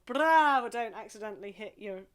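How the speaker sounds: big shouted bravo; loud cheer. Shown as a demonstration. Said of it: "get your hands involved".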